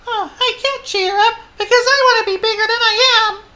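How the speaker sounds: very high, loud wailing tiny mouse; Squeak. A narrator's reading. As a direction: squeal it as a tearful little mouse. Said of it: "said Squeak".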